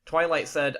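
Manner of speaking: plain reading voice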